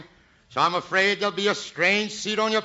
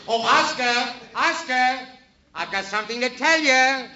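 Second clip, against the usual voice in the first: high teasing call to someone; 8 semitones higher than usual. Acted. Singer calling the pianist before a goodbye gag, high call. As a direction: call across the stage, pitched well up.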